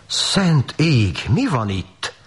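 high, theatrical dismay; farmer's acted moaning; wailing lament. A narrator's reading. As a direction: lament in high-pitched, drawn-out disbelief. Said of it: "siránkozott a gazda".

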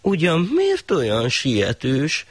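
high, slow wondering question; the shah puzzled by the thief; slow amazed question; astonished shah. Acted. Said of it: "ámult el a sah".

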